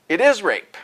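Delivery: "is" pushed up high and loud, falling; stress on is. Real, not acted.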